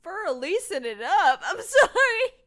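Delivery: loud, high giggling through words; can barely speak for laughing; dissolving into laughter. Real, not acted. Cracking up at the joke.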